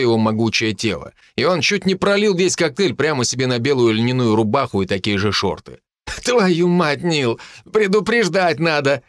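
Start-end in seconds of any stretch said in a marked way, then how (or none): 6.2-9.0 laughing. A narrator's reading. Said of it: "со смехом выговорил он".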